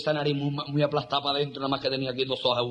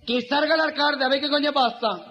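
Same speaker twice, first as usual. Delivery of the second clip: higher-pitched camp protest chant; 7 semitones higher than usual. Acted. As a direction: call out like an effeminate demonstrator. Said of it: "Cinco mil mariquitas de manifestación".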